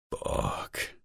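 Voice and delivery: whispered curse; breathy whisper. A narrator's reading. Curse during sex.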